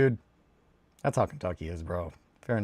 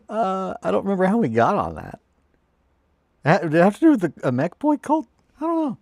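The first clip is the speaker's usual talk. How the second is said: loud, much higher put-on voice; silly high voice; 10 semitones higher, 8 dB louder than usual. Streamer puzzling over the cult topic with chat, silly high voice. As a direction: pitch it way up, playfully.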